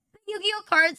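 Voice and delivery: giggling out the Yu-Gi-Oh card; laughing. Real, not acted. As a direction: crack up mid-line. Laughing at own joke.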